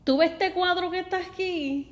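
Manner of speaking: mock-scold like dad pointing at dirty pictures; imitating dad. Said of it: "If he could tell me".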